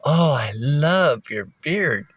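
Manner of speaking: put-on slow, drawn-out gushing girl; lightly voiced swooning compliment; imitating the girl. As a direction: stretch it out like a smitten barista. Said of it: "and she goes".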